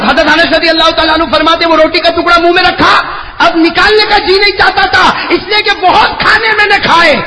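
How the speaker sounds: high loud sermon shouting; fervent sermon shout; preaching at full force. Acted. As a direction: rouse the crowd. Preacher climax.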